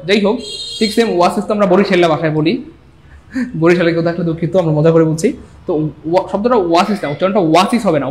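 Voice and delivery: ordinary talk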